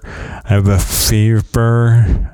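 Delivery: "fever" drawn out slowly through missing teeth; slow, careful. Shown as a demonstration. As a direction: carefully spell out "I have a fever". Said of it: "Let's say some words I can't say".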